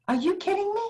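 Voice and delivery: high, disbelieving squeal; price shock. Retold or imitated. Mother at Disneyland reacting to the price tag, high and disbelieving.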